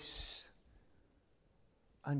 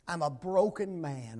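ordinary talk, nothing marked

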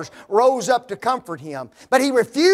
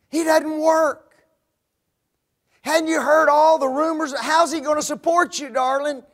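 disapproving parents; 6 semitones higher than usual. Retold or imitated. Parents warning daughter against her fiancé, disapproving. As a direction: warn her off him, with disgust.

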